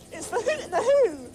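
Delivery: ordinary talk, nothing marked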